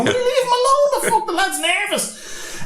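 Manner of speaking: very high-pitched protest, laughter around it; mock indignant plea. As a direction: plead for him in a comic squeal. Viewer defends nervous climber from mockery.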